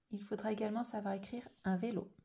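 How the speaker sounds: usual speaking voice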